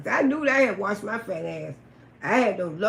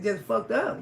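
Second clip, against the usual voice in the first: ordinary talk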